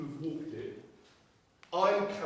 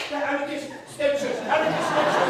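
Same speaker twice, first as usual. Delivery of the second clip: higher, louder mock panic over the radio; mocking guard on walkie; 5 semitones higher, 6 dB louder than usual. Guard on the walkie-talkie to his boss, panicky and laughing. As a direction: ham up a flustered guard reporting in, laughing. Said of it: "we hear him on the walkie-talkie going".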